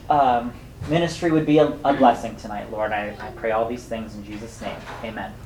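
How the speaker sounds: soft, reverent prayer voice, trailing off; closing prayer. Real, not acted. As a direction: close the prayer gently before God.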